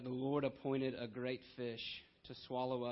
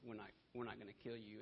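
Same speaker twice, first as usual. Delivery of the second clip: much softer, lower reluctant aside; conscience-stricken refusal; 4 semitones lower, 12 dB quieter, faster than usual. Acted. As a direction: back off quietly, voicing reluctant sailors. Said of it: "these guys have a conscience".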